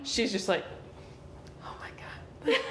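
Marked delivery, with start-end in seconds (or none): 1.6-2.6 high, whispered panic; tiny squeaked whisper of alarm; panicking. Acted. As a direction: panic under your breath.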